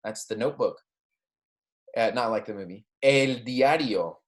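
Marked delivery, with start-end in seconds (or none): 3.0-4.1 "El diario" said slowly, syllable by syllable; slow model pronunciation. Shown as a demonstration. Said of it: "Make sure you're repeating after me".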